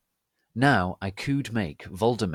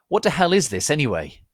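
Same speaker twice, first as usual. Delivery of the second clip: higher and louder, sneering; mean cackle; 7 semitones higher, 9 dB louder, faster than usual. A narrator's reading. Dumbledore mocking the Avril Lavigne song, high mean sneer. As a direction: scoff at the song with mean glee. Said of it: "he cackled meanly".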